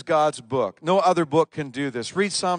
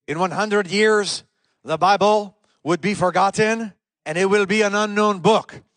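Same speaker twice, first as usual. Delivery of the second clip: attempted French accent; 6 semitones higher, 7 dB louder than usual. Preacher voicing Voltaire's boast in a mock French accent. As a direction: ham up a shaky French accent for the scoffing philosopher. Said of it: "I can't do a France, French accent".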